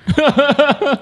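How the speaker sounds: loud high laugh; burst of laughter. Real, not acted. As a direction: laugh it out embarrassed. Guest laughs at focus on sex.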